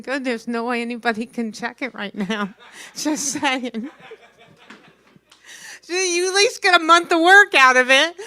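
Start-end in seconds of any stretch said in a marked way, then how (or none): 5.8-8.1 louder, higher mock-helpful punchline. Real, not acted. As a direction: sell the joke loud. Punchline to audience.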